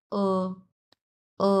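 "ও" as an unrounded back vowel, lips spread; a held "uh"-like "ও" with no rounding. Shown as a demonstration. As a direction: say "ও" through a slight smile, teeth showing. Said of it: "দুই সাইডের দাঁত".

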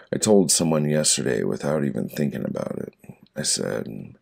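normal talking voice

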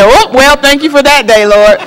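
wry laughing thanks to the Lord; amused ironic gratitude; laughing mock thanks. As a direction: chuckle through a sarcastic thank-you. Mock thanks to God after falling.

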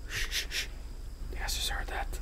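barely audible whisper; hushed whisper. Real, not acted. Streamer asking viewers about a noise, whispering. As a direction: shush them, then whisper to listen.

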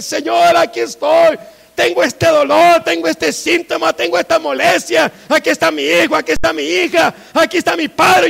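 loud, high, urgent shouted prayer; fervent, hammering plea; crying out in prayer. Shown as a demonstration. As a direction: shout each need to God, one after another. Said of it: "Clame y dígale".

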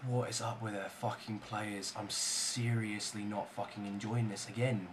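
low, very soft grumble; fed-up muttering. Real, not acted. Gamer fed up with his players, fed-up muttering. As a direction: grumble quietly to yourself, deflated.

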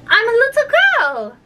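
put-on high, uncertain little-girl voice; unsure answer in a high voice; doubtful. A narrator's reading. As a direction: offer it doubtfully, pitched high. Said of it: "said Alice rather doubtfully".

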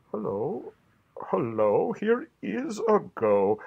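ordinary delivery

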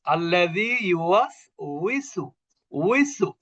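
slow recited আল্লাযী ইউওয়াসউইসু with a clear rounded উই; Quranic recitation. Shown as a demonstration. Teacher reciting the verse slowly as an example. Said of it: "ওয়াও জের উই".

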